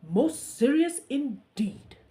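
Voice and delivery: loud, slow and solemn; solemn guard; grave soldier. Acted. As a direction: weigh every word like a stern guard. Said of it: "replied the soldier".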